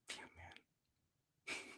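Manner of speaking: hushed, breathless aside of disbelief; exasperated whisper. Real, not acted. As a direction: whisper it, fed up.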